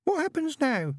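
put-on very high small-girl voice; high childish question; little sister. A narrator's reading. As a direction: ask squeakily like a six-year-old. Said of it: "said Pepper's sister".

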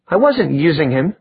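mildly indignant, pitch lifted; indignant denial. Acted. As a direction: deny it defensively. Denial in quarrel.

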